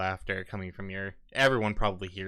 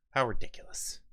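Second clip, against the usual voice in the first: muttered aside; 3 semitones higher than usual. Acted. Grumbling kidnapped captive aside.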